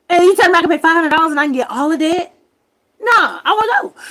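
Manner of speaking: loud high outburst, fast; outraged rant. Real, not acted. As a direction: shout it in disbelief. Ranting about unfair rent.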